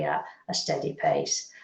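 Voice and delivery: breathy whisper, dropped right down; hushed, unvoiced whisper of advice. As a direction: whisper it like a gentle secret. Repeats phrase as gentle advice.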